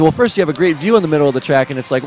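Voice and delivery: ordinary delivery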